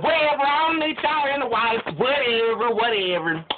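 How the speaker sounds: high, fading brush-off; dismissive whatever. Acted. Devil shrugging off the angels with a dismissive whatever. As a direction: wave them off, whatever.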